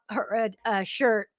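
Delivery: choking on something mid-sentence; words caught in the throat. Real, not acted. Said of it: "I'm just choking".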